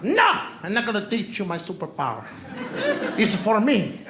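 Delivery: put-on lightly accented teasing refusal; a hint of a thick Spanish accent; mock wife refusing. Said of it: "She calls it her superpower".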